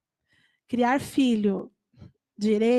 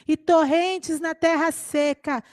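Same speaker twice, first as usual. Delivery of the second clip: raised, measured Bible reading voice; scripture reading; 6 semitones higher than usual. Acted. Preacher reads Isaiah aloud to the church, solemn scripture reading. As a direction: proclaim the verses slowly to the church.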